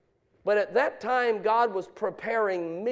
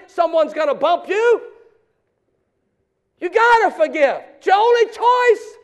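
loud, high-pitched sermon exhortation; full-force preaching; 9 semitones higher, 10 dB louder than usual. Real, not acted. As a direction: drive it home to the congregation, building to a shout. Preaching climax to congregation.

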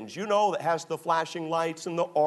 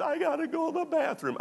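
put-on much higher little-boy voice; 13 semitones higher than usual. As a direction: pipe up like a sleepy child. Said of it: "and he says".

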